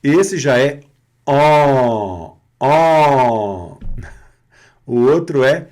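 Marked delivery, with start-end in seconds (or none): 1.2-3.8 nasal "ó" held long, mouth not smiling; som nasal an. Shown as a demonstration. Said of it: "Sacou a diferença?". (som nasal an bem longo)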